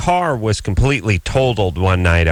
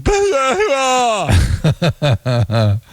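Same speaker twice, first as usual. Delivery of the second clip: a high, muffled yelp from sleep; strangled dream bellow; 11 semitones higher than usual. Retold or imitated. Re-creating his sleep-talk bellow for the doctor. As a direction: bellow like you can't get words out. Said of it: "it just came out like".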